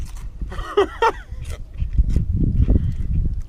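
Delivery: loud squeaky breathless laugh; high squealing laugh. Real, not acted. Laughing at own heat intolerance.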